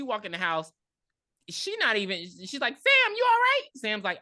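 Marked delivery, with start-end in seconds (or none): 2.8-3.6 loud, very high-pitched calling out; mock worried mom. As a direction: imitate a mother shrieking her concern. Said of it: "stops being caring".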